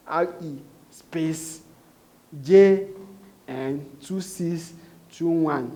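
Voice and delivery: slow, spelled-out dictation, each item apart; dictating slowly. Shown as a demonstration. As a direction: dictate the code slowly, letter by letter. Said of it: "And I say".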